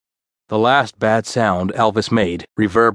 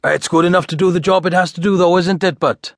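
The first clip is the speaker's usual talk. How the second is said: high, lilting Welsh voice; pitched-up Welsh verdict; broad Welsh accent; 8 semitones higher than usual. A narrator's reading. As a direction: pass judgment in a sing-song Welsh accent. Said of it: "George looked up from the script".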